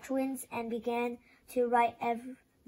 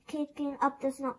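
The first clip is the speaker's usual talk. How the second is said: ordinary delivery, nothing marked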